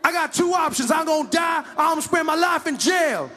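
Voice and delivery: high loud pleading; yelling suspect; shouted protest. Acted. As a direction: protest innocence at full voice. Suspect refusing.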